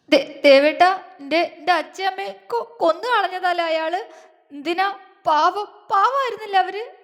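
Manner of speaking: high, stammering sobbed pleading; wailing cry. A narrator's reading. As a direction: cry it out in broken gasps. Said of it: "അവൾ ഉറക്കെ അലറിക്കരഞ്ഞു".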